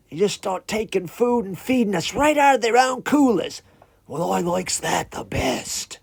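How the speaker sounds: chatty creature host, put-on voice; talking iguana. Iguana telling a visitor about tourist boats, high put-on voice. Iguana describes island life.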